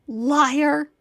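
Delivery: loud bitter yell of betrayal; hateful shout. Acted. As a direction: spit the word with hatred. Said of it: "Her voice full of hate".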